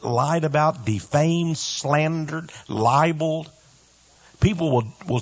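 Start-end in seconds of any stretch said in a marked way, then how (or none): none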